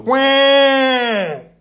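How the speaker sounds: high honking quack; goose quack. A narrator's reading. The goose flies out of the fox's mouth with a loud high quack.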